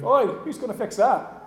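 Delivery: a light mock shout upward. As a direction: call up cheekily at the men above. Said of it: "shouting up at the guys on the roof".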